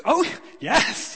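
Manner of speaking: high, loud mock cheery yes; eager agreement. Minister mishearing the lady, answering eagerly. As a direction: play the half-deaf minister nodding along.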